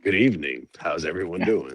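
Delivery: low smooth leading man greeting; deep polished announcer intro; movie star voice. Acted. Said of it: "With the movie star voice".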